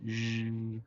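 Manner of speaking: Zh held as a buzzing voiced sh; voiced zh sound. Shown as a demonstration. Teacher isolates the zh sound for students. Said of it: "un poquito más sonoro".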